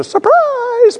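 high-pitched, sing-out mock surprise; playful surprise. Pastor tells crew he vanished, playful high surprise.

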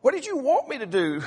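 high, baffled husband voice. Retold or imitated. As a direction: play the clueless husband, voice up high. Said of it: "He said".

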